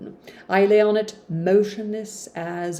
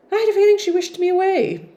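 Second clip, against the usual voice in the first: much higher, louder and fast, overplayed; dramatic reread; 12 semitones higher, 5 dB louder, faster than usual. Reader rereading Jane's complaint about Hannah, mock melodramatic. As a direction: reread it as a hammed-up wounded heroine.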